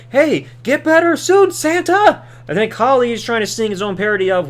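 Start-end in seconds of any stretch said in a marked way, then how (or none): 0.1-2.2 Hornswoggle calling out. Retold or imitated. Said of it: "He's like".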